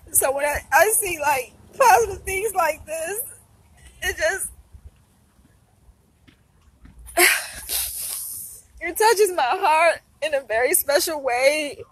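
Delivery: high crying voice, still talking; speaking through tears; tearful. Real, not acted. Touched by positive cards, talking while crying. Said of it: "I'm a big crybaby".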